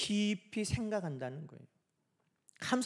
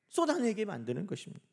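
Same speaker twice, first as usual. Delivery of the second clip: usual speaking voice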